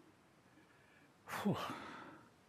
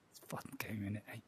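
hushed, softer aside; whispering; 5 semitones lower, 10 dB quieter, faster than usual. Acted. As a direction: murmur it under your breath.

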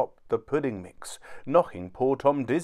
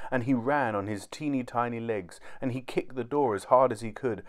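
ordinary delivery, nothing marked

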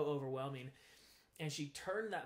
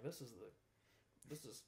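hushed, doubting mutter under his breath; a soft, sceptical aside to himself; muttered disbelief; 4 dB quieter than usual. Retold or imitated. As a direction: quietly scoff at the strange treatment. Incredulous at the pencil therapy.

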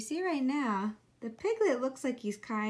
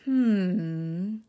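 thoughtful hmm; 2 semitones lower, 5 dB louder than usual. A narrator's reading. Fox ponders.

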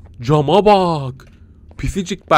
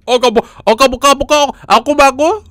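high, loud mock gibberish; creature babble; 10 semitones higher, 4 dB louder than usual. Gamer imitating the creature's baby babble.

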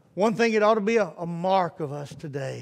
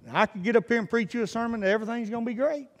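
ordinary talk

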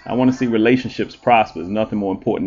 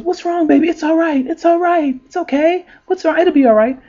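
high, sing-song comforting parent voice; hammed-up cooing mother; soothing parent; 3 dB louder than usual. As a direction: coo at the crying little girl. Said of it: "the parent goes".

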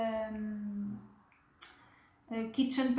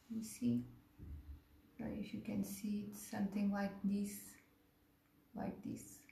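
whispered instruction; 3 semitones lower, 10 dB quieter than usual. Real, not acted. Teacher showing fine brushwork.

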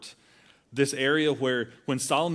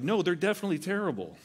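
insistent friends; 7 semitones higher, faster than usual. Retold or imitated. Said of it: "They're like".

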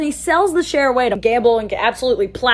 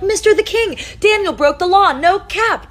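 eager tattling; 7 semitones higher than usual. Acted. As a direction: rush in breathless to tell on him. Officials tattling to the king.

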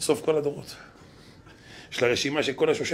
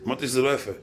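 ordinary talk, nothing marked

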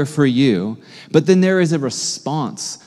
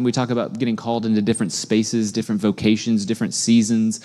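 ordinary talk

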